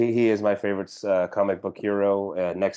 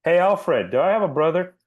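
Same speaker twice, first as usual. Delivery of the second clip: lightly acted, raised-pitch question; mock hero asking butler; 8 semitones higher than usual. Hero asks Alfred about a brother, lightly hammed-up. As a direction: call it out higher, mock-innocent.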